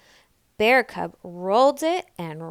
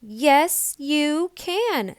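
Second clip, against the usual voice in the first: higher, louder encouraging; Big Mama; 7 semitones higher, 5 dB louder, much slower than usual. A narrator's reading. Big Mama sides with little Nell. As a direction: back her up. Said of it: "said Big Mama".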